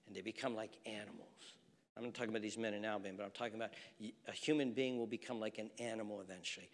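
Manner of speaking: soft and fast, under the breath; hushed rush. Real, not acted. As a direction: rush it quietly like a confession.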